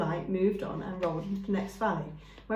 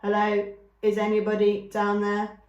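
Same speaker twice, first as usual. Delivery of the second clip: calling down a hole; 6 dB louder than usual. Acted. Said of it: "In a language of animal, she said".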